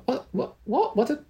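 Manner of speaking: a high, hushed, bewildered question; bewildered father. Retold or imitated. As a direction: ask it like a man who has forgotten his whole life. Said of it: "it all ended in".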